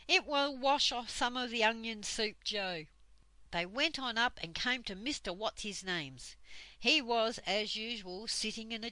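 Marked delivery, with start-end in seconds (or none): none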